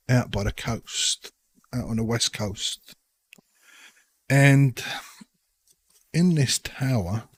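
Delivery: a lightly voiced sly local leaning in; conspiratorial patron. Holbrook sounding out the adventurers about dangerous work, sly. Holbrook tells the tower tale.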